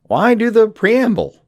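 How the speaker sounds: high, slow, incredulous question; exasperated. Real, not acted. Comedic host baffled by villains' preamble, incredulous and high. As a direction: throw up your hands at the pointless ritual.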